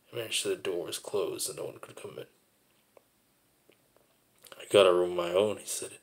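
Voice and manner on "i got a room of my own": loud, proud declaration; father proudly claiming his own room, loudly; loud announcement. Retold or imitated. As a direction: call it out so the whole house hears.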